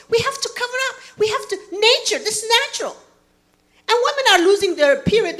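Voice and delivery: ordinary delivery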